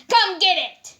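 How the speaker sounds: loud high dare; angry taunt. Acted. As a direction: bait them into a fight. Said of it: "his angry, evil face on".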